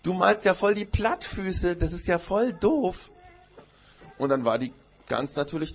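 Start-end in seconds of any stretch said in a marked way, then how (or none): 0.0-3.1 put-on much higher, slow taunting child voice; mocking. Goat jeering at the goose's flat feet, slow and taunting. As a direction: drag out the insult to sting. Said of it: "die Ziege hat sie ausgelacht".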